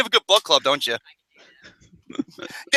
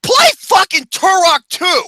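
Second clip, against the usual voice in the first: excited outburst; 8 semitones higher, 5 dB louder, slower than usual. Real, not acted. Excited interruption about a gorier game.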